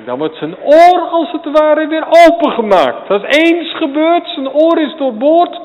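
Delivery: soaring loud pulpit cry; climactic preaching. Acted. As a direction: lift it to a fervent climax.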